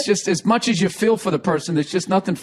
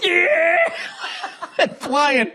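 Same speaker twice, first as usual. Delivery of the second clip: excited yell; 11 semitones higher, much slower than usual. Retold or imitated. Cheering the stunt in retelling.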